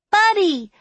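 put-on high, loud child's squeal; excited little girl. A narrator's reading. Little girl running laughing toward the sheriff, excited squeal. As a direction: cry out like a delighted toddler. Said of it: "cried Cupcake".

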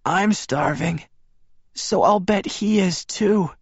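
high, strained voice of a weak woman; hint at a rasp in a high, slow voice; frail female character, pitched well up. A narrator's reading. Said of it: "she rasped, barely audible".

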